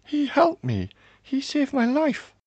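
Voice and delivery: high, loud, urgent plea; begging. A narrator's reading. Daughter begs father to spare her rescuer, high and urgent. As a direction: beg with the voice pushed right up. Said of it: "I begged".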